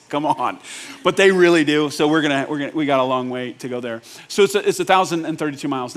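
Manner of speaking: usual speaking voice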